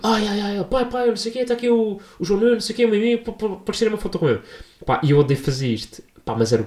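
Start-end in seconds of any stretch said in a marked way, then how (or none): 0.0-4.4 high, flustered voice calling to his dad; hammed-up nervous son; imitating the son. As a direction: babble up to dad, much higher, in a rush.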